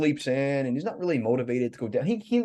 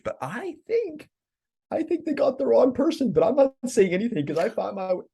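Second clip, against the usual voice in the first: Pip's voice; 9 semitones higher than usual. Retold or imitated. Pip whispering his secret aside.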